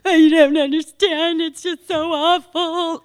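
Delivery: high, loud whining complaint; mock dramatic moaning about how awful it is; whiny self-pity. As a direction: wail it like a self-pitying victim. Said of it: "I would emotionally vomit on".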